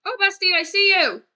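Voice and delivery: high, loud call to a friend; excited calling out. Real, not acted. Spots friend and calls out.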